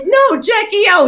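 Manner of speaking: high, loud laughing protest. Retold or imitated. Correcting her friend in the car, loud and high. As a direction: insist through the laughter. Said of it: "I'm like".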